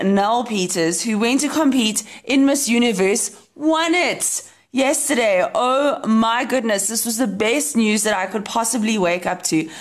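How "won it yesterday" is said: normal talking voice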